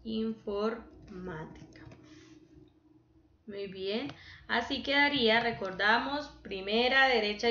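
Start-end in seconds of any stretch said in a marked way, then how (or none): 0.0-1.8 Informática slow and soft, pause before MÁ; stress on MÁ. Shown as a demonstration. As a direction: break Informática into in-for, then MÁ-tica. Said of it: "esa es drújula".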